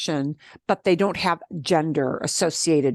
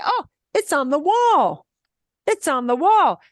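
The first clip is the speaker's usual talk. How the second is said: acted answer; 8 semitones higher than usual. Acts out someone answering.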